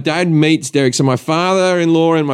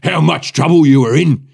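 a lightly voiced gloating security guard, lower and slower at the end; a hint of a smug guard savouring the threat; a touch of a taunting guard; mock stern guard; 4 semitones lower than usual. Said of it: "this is very funny".

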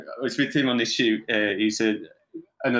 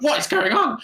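high-pitched shocked yelp, acted out; alarmed outburst; 13 semitones higher, 3 dB louder than usual. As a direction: cry out as if thrown by a sudden lurch. Reliving shock at sudden lurch.

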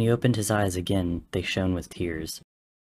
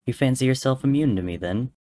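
higher, louder smug challenge; smirking; 6 semitones higher, 5 dB louder than usual. Acted. Dream smugly challenges Techno, smirking. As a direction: taunt with a smirk. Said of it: "Dream smirked".

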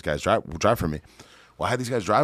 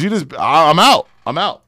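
high, loud exasperated quitting; rival giving up; 9 semitones higher, 12 dB louder than usual. Retold or imitated. Rival bidder quitting the auction, loud and exasperated. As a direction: throw up your hands and shout you're out. Rival quits bidding war.

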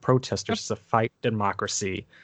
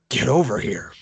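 Scorpion voice. Retold or imitated. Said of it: "sounds like frickin' Scorpion".

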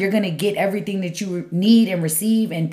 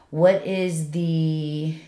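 drawn out; 3 semitones lower, 4 dB quieter, much slower than usual. Real, not acted. Searching for a word.

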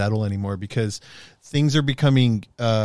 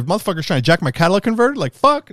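fast, louder, higher tough-guy threat; mock threat; 8 semitones higher, 4 dB louder than usual. Telling a friend how he'd defend his car, hammed-up loud threat. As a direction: rattle it off like you're ready to fight. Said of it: "I said".

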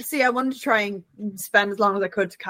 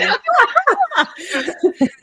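high laugh. Real, not acted. Laughing at the dog.

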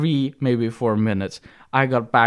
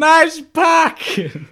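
much higher, loud slow yell; hammed-up mock shout; 7 dB louder, much slower than usual. She repeats the driver's jab as a hammed-up yell. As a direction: bellow it like a cartoon angry driver.

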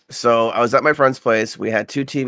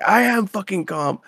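higher mock-furious "I am fucking calm"; mock furious insistence; 10 semitones higher than usual. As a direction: play the friend who is anything but calm. Raging friend insisting.

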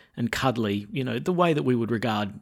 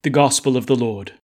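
loud, measured reading from the ambo; gospel proclamation; 7 dB louder than usual. Acted. Priest reading the Gospel aloud to the congregation, loud and measured.

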